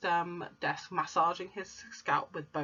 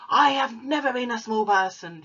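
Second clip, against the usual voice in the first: a touch louder, sharp retort; snapped; 6 semitones higher, 6 dB louder than usual. Acted. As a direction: snap it back. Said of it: "she snapped".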